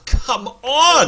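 loud, high cry of protest; groaning shout of come on; exasperated shout. Real, not acted. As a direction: yell it out in outrage at the vote. Protesting the chat's vote.

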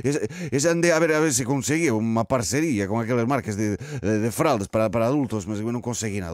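put-on flustered Portuguese in a comic Spanish accent; put-on Spanish accent, stumbling along. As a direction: make excuses in hesitant Spanish-accented Portuguese. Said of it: "tenho andado com muitos nervos".

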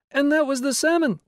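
put-on high, loud eager boy shout. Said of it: "shouted a small boy".

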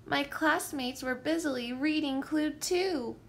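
plain reading voice

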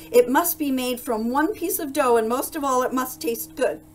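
loud, high-pitched list of demands; commanding. A narrator's reading. Duke dictating terms to the baker, loud and commanding. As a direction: issue orders with full authority.

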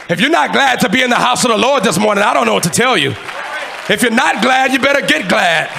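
loud, high shouted sermon in driving phrases; full-force preaching. Real, not acted. Preacher exhorting the church that today is their day, full-force shouted preaching. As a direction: preach it at the top of your voice.

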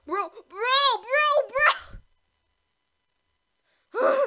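loud, high-pitched panic; high excited shout. Real, not acted. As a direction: yell it in alarm. Tense moment in game.

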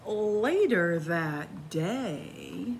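gentle, lively bedtime storytelling; cozy storybook narration; reading to children. A narrator's reading. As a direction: read it like a picture book to little ones. Reads a picture book aloud.

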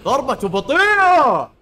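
high, loud, slow call-out; loud drawn-out excited call; excited shout. Real, not acted. Excited during boss fight.

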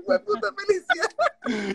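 high voice breaking into laughter; joyful cursing among friends; laughing. Real, not acted. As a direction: cheer through laughter.

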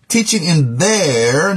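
THEIR slowed and drawn out, rising; stress on THEIR. Real, not acted. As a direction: lean on THEIR and hold it. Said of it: "emphasizing the word there".

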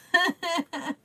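high giggle tumbling down; short, high laugh at herself; amused little giggle. Real, not acted. Laughs about portal going nutso.

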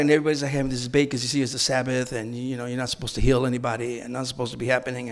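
quick low aside. Real, not acted.

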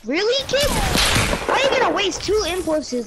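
high, loud outraged yell; raging gamer. Real, not acted.

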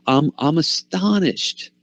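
mock exasperation at the church; incredulous, slowing at the end; astonished disbelief. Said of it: "he might be saying".

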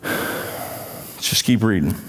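sighed and soft; tired, breathy aside; worn-out mutter; weary sigh. Real, not acted. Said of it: "weary sigh".

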